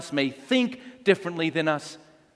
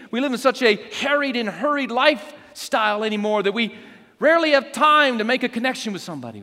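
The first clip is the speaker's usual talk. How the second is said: higher-pitched preaching with emphatic falls; raised preacher's pitch; earnest exhortation; 8 semitones higher, 3 dB louder than usual. Real, not acted. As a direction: urge the congregation, point by point. Exhorting the congregation.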